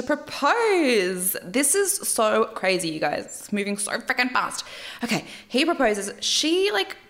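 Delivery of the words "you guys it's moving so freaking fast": usual speaking voice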